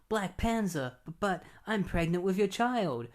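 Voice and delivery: high, hammed-up heroine; comic soap-opera damsel; mock-melodramatic woman. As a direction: stammer the confession in a high pretend voice. Heroine's confession, read aloud.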